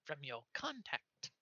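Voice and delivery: high, fast gnome stammering; flustered gnome. Acted. Gnome messenger stammering a message, high and squeaky. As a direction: squeak and stumble like a nervous gnome. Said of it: "a high squeaky voice".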